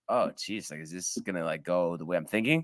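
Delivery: low, hushed retold worry; worried inner thought. Golfer retells his dread after five sixes, low and hushed. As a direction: drop right down, as if thinking aloud to yourself.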